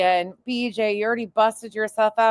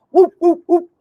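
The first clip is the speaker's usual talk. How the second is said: loud, high whoop whoop like a clapping seal; mock seal whoops; 10 semitones higher, 9 dB louder than usual. Mocking the clapping seal emoji.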